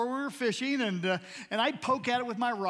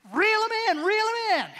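high, loud urgent calling out; urgent shout; 12 semitones higher, 7 dB louder than usual. Retold or imitated. Husband urging his wife to reel in the fish, loud urgent shout. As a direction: yell it to her, insistent.

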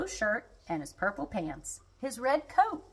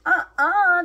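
loud, high "uh-uh"; emphatic refusal; 10 semitones higher, 9 dB louder than usual. A narrator's reading. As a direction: contradict loudly, pitched way up. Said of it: "declared the tiger with the silver shoes".

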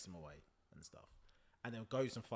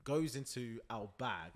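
ordinary talk, nothing marked

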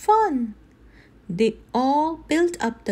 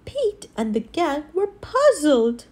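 ordinary delivery

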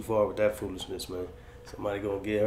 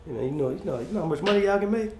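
excited hype; 8 semitones higher, faster than usual. Real, not acted. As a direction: pitch jumps high, faster. Hyping the boxing money.

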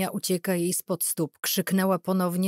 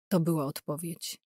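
usual speaking voice

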